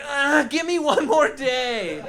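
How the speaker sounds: high, slow mock agonized plea; pleading scientist. Scientist asked to pick a flavor, mock anguished. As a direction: beg in a hammed-up stretched voice. Scientist begs for more time.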